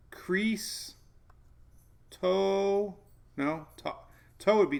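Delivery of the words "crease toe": "Crease" and "toe" drawn out as separate syllables, pitched up; syllable-by-syllable pronunciation. Shown as a demonstration. Reading a storm name's pronunciation guide to viewers, syllable by syllable. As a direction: sound out "Crease", then "toe", slowly and deliberately. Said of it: "pronunciation guide".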